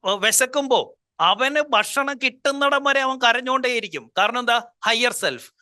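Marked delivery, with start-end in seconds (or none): none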